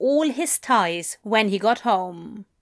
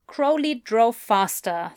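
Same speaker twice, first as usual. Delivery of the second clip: ordinary delivery, nothing marked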